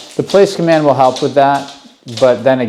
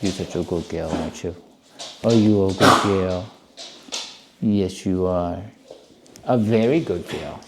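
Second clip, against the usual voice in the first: soft praise; 7 semitones lower, 8 dB quieter, faster than usual. Real, not acted.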